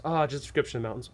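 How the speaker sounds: fast, offhand answer; a player quickly brushing off the missed description; breezy quick reply. Pretend. Casual reply to returning player.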